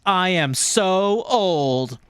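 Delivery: slow, high, hammed-up complaint; playful lament. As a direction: stretch each word out for comic drama. Reading aunt's excited email.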